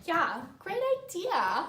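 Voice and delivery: high, loud, drawn-out approval; mock the coach's gushing agreement; imitating the coach. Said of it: "the coach was like".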